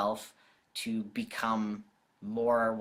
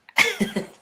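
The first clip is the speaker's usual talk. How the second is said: delighted high laugh; 11 dB louder than usual. Real, not acted. Dog comes onto camera.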